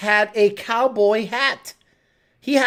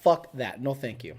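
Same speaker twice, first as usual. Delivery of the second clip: ordinary talk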